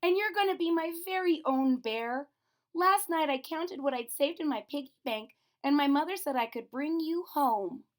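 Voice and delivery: put-on high, eager little girl; little girl Lisa. A narrator's reading. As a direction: lift the voice up like a small excited girl. Lisa voiced in story.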